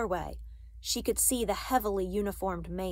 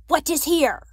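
higher, much louder soldier's bark; loud demand; 8 semitones higher, 11 dB louder than usual. A narrator's reading. Soldier outside the girls' door questioning mother, loudly. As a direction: fire off the question as an order.

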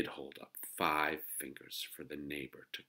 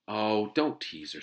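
loud, slow, higher-pitched rebuke; protective reproach; 4 semitones higher, 8 dB louder than usual. A narrator's reading. Older sister protects little sister from friend, reproachful. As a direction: stretch it out as a sisterly telling-off. Sister defends teased child.

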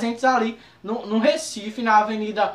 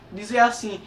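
normal talking voice

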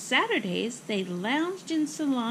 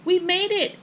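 high, loud, triumphant; excited exclamation; 8 semitones higher, 6 dB louder than usual. Acted. Girl arrives at theater in time, excited exclamation. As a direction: exclaim it with raised, higher voice. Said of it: "Ava explained".

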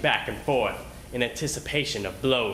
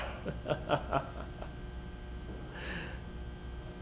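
breathy cruel chuckle; a soft cold laugh; 5 semitones higher, 10 dB quieter than usual. A narrator's reading. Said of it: "The men around me laughed".

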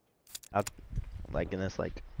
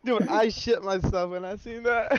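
laughing; 12 semitones higher, slower than usual. Real, not acted. Still laughing after kill.

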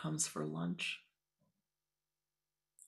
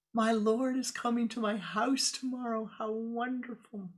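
higher, louder, overjoyed exclaiming; thrilled; 7 semitones higher, 6 dB louder than usual. Acted. The young Swami rejoicing that her Lord will visit, thrilled. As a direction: burst out with delight, slowing at the end.

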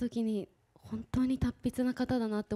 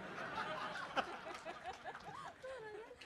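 high laugh; 13 semitones higher, 13 dB quieter than usual. Real, not acted. Actress hearing a letter exists.

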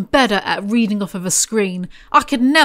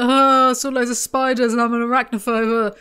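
silly put-on voice, faking amusement; exaggerated jokey mimicry; mock laughing initiate; 3 semitones higher, 4 dB louder than usual. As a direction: play a giggly initiate, hammed up. Imitates initiates laughing over their fears.

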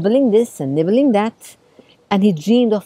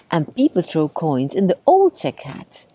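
usual speaking voice

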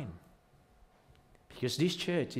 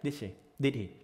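usual speaking voice

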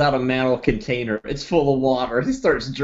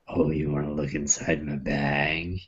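low gruff voice; 9 semitones lower, 8 dB quieter than usual. Acted. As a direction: voice the creepy hermit low. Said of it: "He says".

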